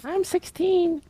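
very high squeaky voice; squeaky girly voice. Pretend. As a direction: squeal it coyly.

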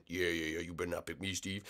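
low, very soft grumble; quiet, low-pitched aside; muttered warning. Acted. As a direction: mutter it under your breath. Muttered warning after host's answer.

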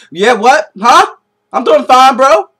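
talking far too loud without hearing it; mock yelling. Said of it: "Why are you yelling, bro?".

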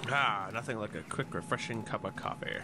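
low, soft office-worker voice; a lightly voiced relaxed coworker sipping coffee; calm office worker voice. Gamer voices a calm coworker greeting colleagues, soft and low.

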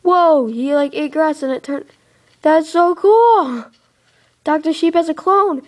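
excited wonder. Real, not acted. Sheep eats grass, wool regrows.

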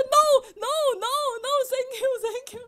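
a mock-frightened tourist backing away; imitating panicked refusal. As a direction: friend politely panicking at the shoe shiner. Friend refusing again.

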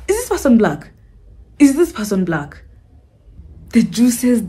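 ordinary delivery

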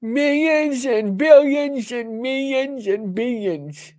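sneering imitation of the speech, slow and high; mock president. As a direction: drag it out like a pompous politician. Mocking the president's clip.

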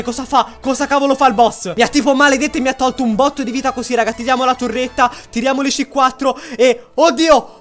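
ordinary talk

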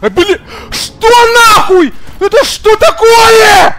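high, slow, drawn-out panicked yell. Real, not acted. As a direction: shriek it out in disbelief. Panicked screaming at game.

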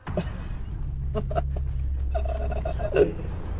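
breathless high-pitched wheeze laugh; high wheezing laugh. Real, not acted. Streamer laughing at his own rude joke, wheezing laugh.

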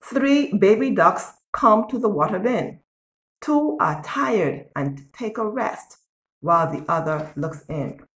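ordinary delivery, nothing marked

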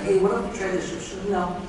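ordinary talk, nothing marked

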